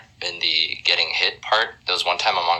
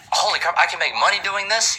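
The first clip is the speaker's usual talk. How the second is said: high, excited disbelief; young animator amazed at his first paycheck; thrilled kid; 12 semitones higher than usual. Retold or imitated. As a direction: squeal it like a kid who just got paid. Kid thrilled about first pay.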